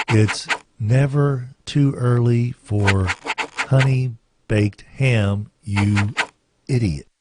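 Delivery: very slow, low, drawn-out goofy voice; slow, low mock voice calling him an idiot; silly ad voice. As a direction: stretch every word like a cartoon fool. Ham ad line in silly voice.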